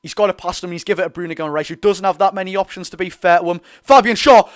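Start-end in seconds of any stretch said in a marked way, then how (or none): 3.8-4.5 loud, higher call of a player's name; excited shout. Real, not acted. Commentator expecting Schär to smack it, excited shout.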